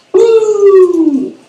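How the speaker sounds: high loud wrestler woo; Ric Flair woo. Retold or imitated. [name] mimicking the local whooping again. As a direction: belt out the whoop. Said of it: "Like Ric Flair".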